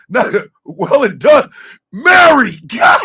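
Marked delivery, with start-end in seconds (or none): 1.9-3.0 loud, high cry of the name; scandalised shout; exclaiming. Real, not acted. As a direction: call out her name in mock outrage. Exclaiming at a chat member's joke.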